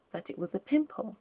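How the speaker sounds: ordinary delivery, nothing marked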